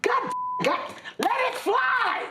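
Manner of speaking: very high, exasperated shouting; mock-furious yelling. Host yelling at Joe to hit the button, high mock fury. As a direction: yell the order high, stretching the words. Host yells at crew for cue.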